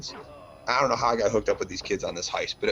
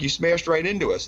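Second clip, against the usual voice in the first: higher-pitched indignant accusation; retold accusation; 8 semitones higher, 4 dB louder than usual. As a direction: confront him over the crash. Retells accusing the driver.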